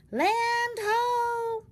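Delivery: high, loud cry of land sighted; shouted lookout call. Acted. Sailor calls to the crew that land is sighted, loud high cry. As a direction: call it out from the masthead.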